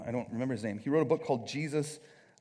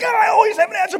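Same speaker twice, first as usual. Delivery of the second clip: very high, hammed-up yell; mock frantic shout; 17 dB louder, faster than usual. Preacher mocking ever-ready debaters, frantic high shout. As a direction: shriek it like you cannot wait to fire. Mocking trigger-happy answer, escalated.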